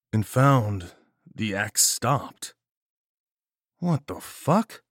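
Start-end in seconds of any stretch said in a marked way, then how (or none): 3.8-4.8 slow, high disbelieving question; stunned. Acted. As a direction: drag it out in disbelief. Surprise, axe stopped.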